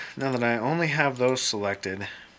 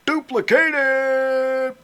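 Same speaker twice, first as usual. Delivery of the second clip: high, slow, loud proclamation; drawn-out theatrical shout; booming command; 10 dB louder, much slower than usual. Pretend. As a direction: declare it grandly like a herald. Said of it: "I keep speaking like I'm an English person".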